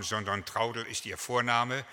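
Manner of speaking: ordinary talk, nothing marked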